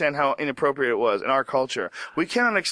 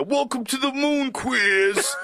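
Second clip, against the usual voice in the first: mock announcer; 10 semitones higher, slower than usual. Pretend moon man announcing.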